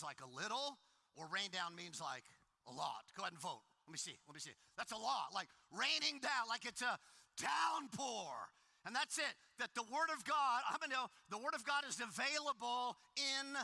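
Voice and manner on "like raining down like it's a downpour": slow, loud, stretched raining down; emphatic downpour. Shown as a demonstration. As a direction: hammer home the downpour. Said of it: "explaining rain down means a lot".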